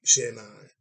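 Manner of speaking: usual speaking voice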